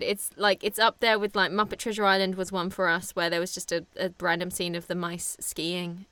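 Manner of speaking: ordinary talk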